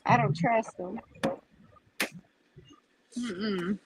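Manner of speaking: ordinary talk, nothing marked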